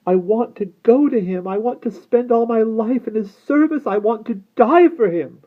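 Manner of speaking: put-on high, impassioned girl's voice; high, desperate devotion; faltering plea. A narrator's reading. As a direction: declare it high, loud and yearning. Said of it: "she faltered".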